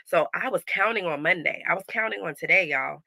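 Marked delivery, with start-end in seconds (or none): none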